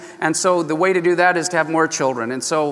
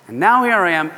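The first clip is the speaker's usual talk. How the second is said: usual speaking voice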